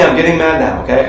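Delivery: usual speaking voice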